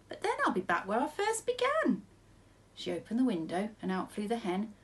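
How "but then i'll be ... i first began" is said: put-on high, worried old lady voice. A narrator's reading. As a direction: protest in a high voice.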